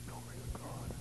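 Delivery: a hushed, reverent whisper; whispered praise. Real, not acted. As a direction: whisper it, glory to God. Preacher breathes praise softly.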